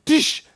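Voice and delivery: a sharp, high mock punch noise; punch sound effect. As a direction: smack the air with a quick Dish. Imitated punch sound in story.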